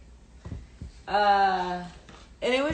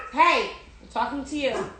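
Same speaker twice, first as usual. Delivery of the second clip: real scolding; 2 semitones higher than usual. Real, not acted. Telling off the noisy girl, firm scolding. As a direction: demand attention after the shouting.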